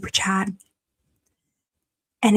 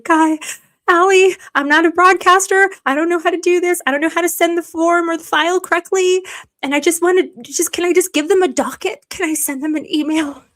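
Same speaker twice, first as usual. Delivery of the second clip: higher, louder, mock-panicked rambling to Allie; flustered pleading to Allie; 9 semitones higher, 5 dB louder than usual. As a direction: retell your own fluster, a bit pitched up. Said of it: "This morning, I was like".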